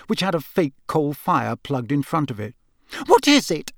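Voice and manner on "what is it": high-pitched, gasped question; frightened gasp. A narrator's reading. Aunt terrified by the fire, gasping. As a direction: gasp it out in alarm. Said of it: "gasped Aunt Petunia".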